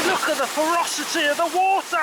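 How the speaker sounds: thrilled shout over rushing water; excited shout. Real, not acted. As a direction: call out in awe.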